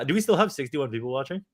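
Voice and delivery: normal talking voice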